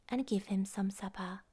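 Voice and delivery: ordinary delivery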